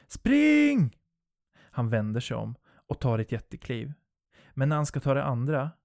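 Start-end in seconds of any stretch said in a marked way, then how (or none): 0.1-1.0 high, loud panicked shout; panicked scream. Acted. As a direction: scream with everything you have. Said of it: "jag tar i allt jag kan när jag skriker". (panikslaget skrik)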